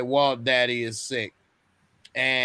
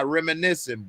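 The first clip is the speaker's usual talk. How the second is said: usual speaking voice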